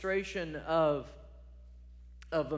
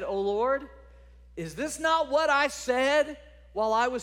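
complaining prayer; 7 semitones higher, 5 dB louder than usual. Acted. Jonah's complaining prayer to God.

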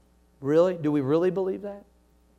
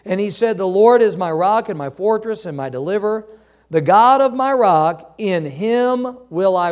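scripture reading; 2 semitones higher, 4 dB louder than usual. Acted. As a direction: proclaim the verse to the congregation. Reading scripture aloud to church.

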